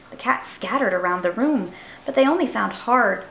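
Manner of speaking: ordinary delivery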